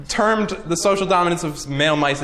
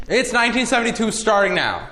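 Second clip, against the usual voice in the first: dramatic narrator; 6 semitones higher than usual. Acted. Dramatic documentary-style time intro.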